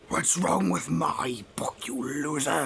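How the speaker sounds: sneering whispered toy voice, slow; heckling toy voice. Pretend. The Funko Pop mocks the reviewer, sneering whisper. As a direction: rasp insults like a snotty little toy.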